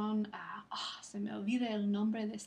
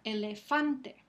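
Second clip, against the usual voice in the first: "elefante" with FAN stronger than the rest; stress on FAN; 3 dB louder, slower than usual. Shown as a demonstration. Teacher quizzing students on the stressed syllable, stress on FAN in "elefante". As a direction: punch the third syllable of "elefante". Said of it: "cuál es la sílaba que tiene el énfasis".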